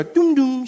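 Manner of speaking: high two-note doom doom; chime noise. Retold or imitated. Imitating the phone chime to the audience.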